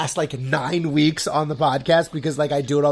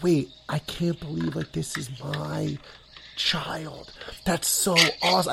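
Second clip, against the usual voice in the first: awed murmur; 6 dB quieter than usual. Retold or imitated. Awed father watching wife and baby.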